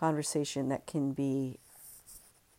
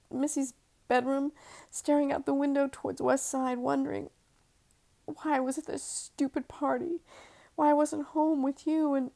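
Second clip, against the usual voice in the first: higher, tender longing voice; wife's voice; 9 semitones higher than usual. Acted. Wife talks to husband in dream.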